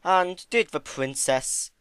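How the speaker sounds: slow, sneering drawl; slow sneer about the princess. A narrator's reading. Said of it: "the sneer in his voice".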